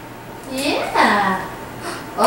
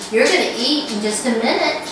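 usual speaking voice

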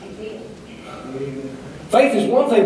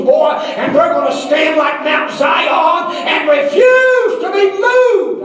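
preaching at full force; 8 semitones higher, slower than usual. Acted. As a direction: build it up and shout it from the pulpit. Climax of preaching.

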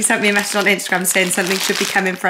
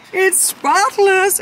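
amazed delight; 11 semitones higher, much slower than usual. Real, not acted. Showing the freshly cleaned car.

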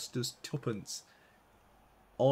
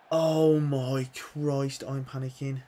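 loud, very slow panicked cry; drawn-out panic as the defence cracks; panicking; 3 semitones higher, 9 dB louder, much slower than usual. Real, not acted. Gamer panicking as the opponent attacks, loud and drawn out.

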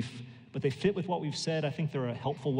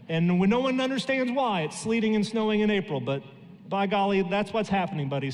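louder, higher mock outrage; incredulous complaint about April snow; exasperated; 5 semitones higher, 4 dB louder than usual. Real, not acted. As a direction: throw up your hands at the weather.

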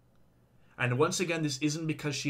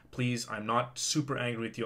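normal talking voice

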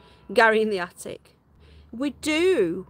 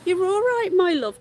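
higher, soothing pet talk; 7 semitones higher, 5 dB louder than usual. Real, not acted. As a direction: coo to the animal to settle her.

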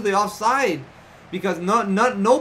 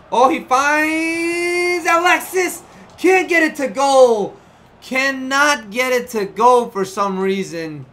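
excited commentary; 6 semitones higher, 7 dB louder, slower than usual. Real, not acted. Chance missed in the match.

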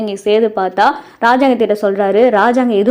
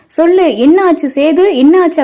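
high, louder pressing questions; urgent demand; 7 semitones higher, 5 dB louder than usual. A narrator's reading. Easwari presses to know what happened, urgent demand. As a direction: press for an answer with raised voice.

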